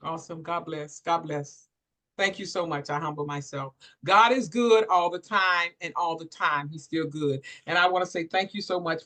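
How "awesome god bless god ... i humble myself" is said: humbled and quiet. Real, not acted. Host moved after testimony.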